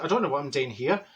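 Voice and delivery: normal talking voice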